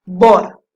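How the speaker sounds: "bor" punched very loud on its own; stressed syllable. Shown as a demonstration. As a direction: hit "bor" hard as the strong syllable. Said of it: "la sílaba tónica es la segunda".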